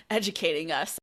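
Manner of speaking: ordinary talk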